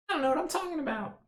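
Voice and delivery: squeaky high, fast mimicry; mocking high voice. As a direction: parrot her in a high voice. Mocks partner's mixed-up words.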